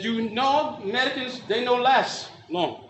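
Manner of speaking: put-on slow Japanese-accented warning; Japanese judge. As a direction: imitate a Japanese judge, drawing out the ending. Judge quoted, broken accented English.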